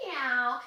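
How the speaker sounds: short curious meow; a quick curious kitty meow; playful little meow; cat meow. Shown as a demonstration. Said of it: "imagine a very curious cat tail".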